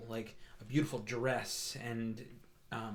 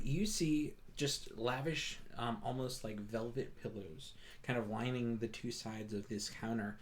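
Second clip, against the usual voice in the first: ordinary talk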